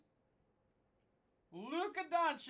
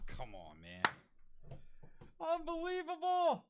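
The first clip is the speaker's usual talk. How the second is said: usual speaking voice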